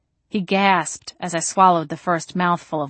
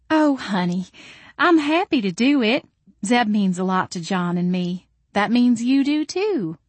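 higher, fussing motherly reassurance; doting Southern mama; 4 semitones higher than usual. A narrator's reading. Mama comforts the grateful mother-to-be, doting. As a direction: coo it like a gracious hostess mother.

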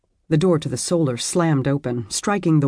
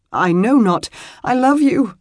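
high, tearful plea; choking on tears as she says it; thick with tears; 8 semitones higher, 6 dB louder than usual. Acted. Wife clinging to her husband, tearful and high. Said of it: "her voice thick with tears".

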